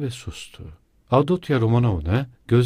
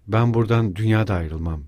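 plain reading voice

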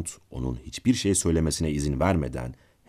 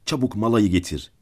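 higher, louder narrated shout; mild storyteller's yell, raised in pitch; urgent call; 6 semitones higher, 5 dB louder than usual. A narrator's reading. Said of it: "diye bağırdı".

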